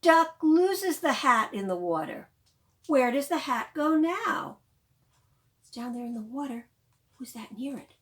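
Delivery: loud, lively storytime reading; reading to children. Acted. Librarian reading The Rainbow Hat aloud to children, lively. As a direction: bring the picture book alive for little ones. Reads picture book to children.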